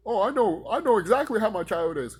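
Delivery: high, loud know-it-all parent imitation; mocking parent.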